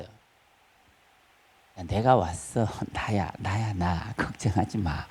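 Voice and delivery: soft, low, hushed comforting as Jesus; tender reassurance. Acted. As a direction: whisper comfort to a frightened friend. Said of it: "지금 그 얘기하는 거예요". (예수님이 제자들에게 속삭이듯 다정하게 안심시키는 목소리)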